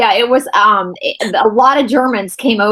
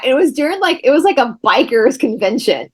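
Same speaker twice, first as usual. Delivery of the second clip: normal talking voice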